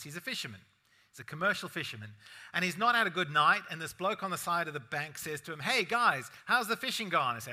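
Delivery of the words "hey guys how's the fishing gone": calling out. Acted. Bloke calls to fishermen.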